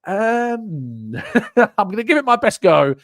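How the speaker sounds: hesitant, high mock voice of someone guessing a name; unsure name reader. Reading a hard name to the streamer, hesitant mock voice. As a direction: play the bloke giving it his best go. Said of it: "you're just like".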